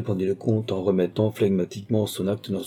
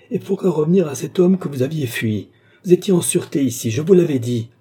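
high, raised, urgent protest; exclaimed reproach; 7 semitones higher, 5 dB louder than usual. A narrator's reading. Princess reproaching Lorenza for returning to him, crying out. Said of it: "s'écria madame Louise".

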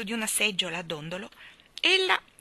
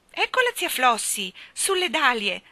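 higher, louder pointing-out cry; girl's shout; 5 semitones higher, 6 dB louder than usual. Acted. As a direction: call out what you have spotted below. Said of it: "gridava Jane indicando in basso".

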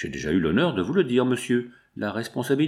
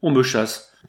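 ordinary delivery, nothing marked

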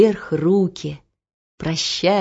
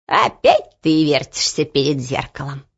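grandmother voice; 5 semitones lower than usual. Acted. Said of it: "сказала бабушка".

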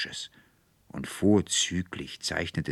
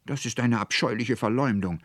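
raised, louder indignant protest; angry cry; 7 semitones higher, 5 dB louder than usual. A narrator's reading. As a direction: call out in wounded indignation. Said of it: "rief Veronika mit zornglühenden Augen".

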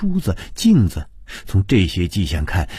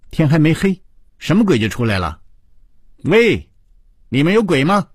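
high-pitched, bold calling out; raised voice; 9 semitones higher, 4 dB louder than usual. A narrator's reading. Bold colleague calling into the toilet at a supposed ghost, raised high voice. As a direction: call out a challenge into the room. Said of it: "周梅提高声音说".

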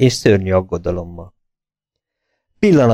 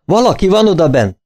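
higher-pitched urgent call; raised narrated alarm; 9 semitones higher than usual. A narrator's reading. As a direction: Lestrade alerts the others through the door, calling out. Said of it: "kiáltott fel Lestrade".

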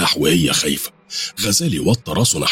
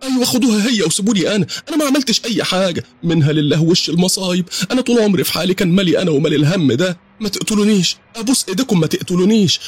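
screaming, begging; 13 semitones higher than usual. Acted. Aziza screaming to the gunmen, begging them to spare her. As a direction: beg for your life in panic, gabbling. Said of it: "هنا صرخت عزيزة".

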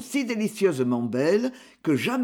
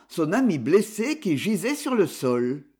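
ordinary delivery, nothing marked

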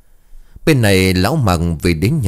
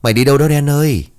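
calling after; 7 semitones higher, faster than usual. A narrator's reading. Boy to his dog running off, calling after it. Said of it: "Tú bấy giờ liền gọi với".

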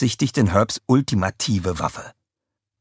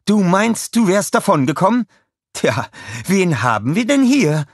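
high, loud gloating taunt; villainess taunting; 11 semitones higher, 5 dB louder than usual. A narrator's reading. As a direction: gloat over the captives. Scarlett taunts Kevin on TV. (hohes, höhnisches Triumphieren)

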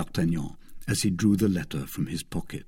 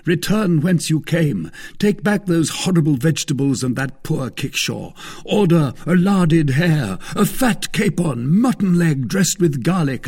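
high, loud orders to a servant; exuberant cry; 7 semitones higher, 5 dB louder than usual. A narrator's reading. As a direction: call out the feast grandly. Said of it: "cried Aramis".

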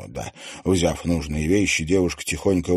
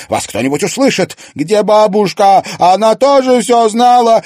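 much higher and louder, yelling; relentless shouting; 10 dB louder than usual. A narrator's reading. Daughter demands where grandmother is, relentless shouting. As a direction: keep shouting without letting up. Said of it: "не унималась Луиза".

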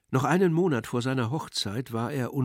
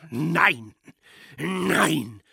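general's cry; 5 semitones higher, 5 dB louder than usual. Acted. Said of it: "schrie der General".

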